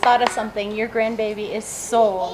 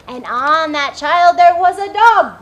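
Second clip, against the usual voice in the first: louder; 5 semitones higher, 9 dB louder than usual. Acted. Loud after the whisper.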